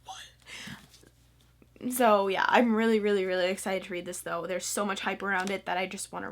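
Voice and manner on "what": breathy, shocked whisper from the crowd. Retold or imitated. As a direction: whisper it like everyone is appalled. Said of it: "everyone's like".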